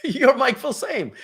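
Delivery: high, slow amazed recognition; starstruck clerk. Retold or imitated. Airline clerk recognizes the famous marketer, awed and high. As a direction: say it slowly in awe.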